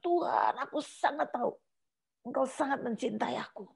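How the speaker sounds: soft, tearful plea to God; a hushed, tearful plea to God; tearful prayer. Retold or imitated. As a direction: pray quietly through tears, pleading. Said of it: "Terus saya nangis".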